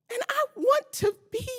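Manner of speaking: real crying breaking through; tearful. Real, not acted. Resident pleading with council, tearful. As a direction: voice catching in tears. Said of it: "so emotional".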